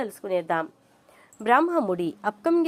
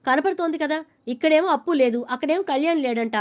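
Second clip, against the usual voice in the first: agitated retold complaint; 6 semitones higher than usual. Dhanyalakshmi arguing agitatedly.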